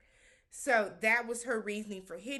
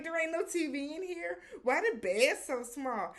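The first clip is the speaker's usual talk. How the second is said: put-on high, whiny complaining boyfriend; spoiled complaint; 6 semitones higher, 3 dB louder than usual. Host imitating a rich boyfriend complaining about the room. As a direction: whine about the tiny room. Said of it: "He was like".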